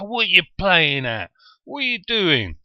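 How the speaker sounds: high, loud outraged aunt at a match; going mental. Retold or imitated. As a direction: imitate a fan yelling furiously at a player. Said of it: "she'd go mental".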